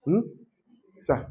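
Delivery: usual speaking voice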